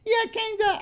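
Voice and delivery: put-on very high falsetto girl; mock girly voice. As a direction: squeak like a silly girl. Girl's chat reply.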